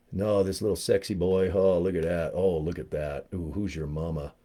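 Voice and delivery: low, soft mock-seductive murmur; playful cooing. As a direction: coo softly over a little toy. Cooing over his Lego toy.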